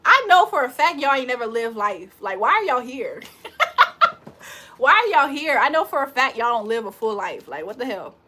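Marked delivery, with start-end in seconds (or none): 3.3-4.5 high-pitched mocking laugh; high cackle. Real, not acted. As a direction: cackle at the trolls. Laughing while roasting chat.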